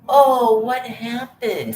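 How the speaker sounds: very high, loud Oh, then slow drawn what happened; mock nosy voice. Mocking the nosy one asking what happened, high and drawn out. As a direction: ham up a fake innocent gasp.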